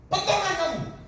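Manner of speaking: high-pitched scolding command; retold angry order. Pastor dares the woman banging her head, high angry retelling. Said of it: "saya marah dia".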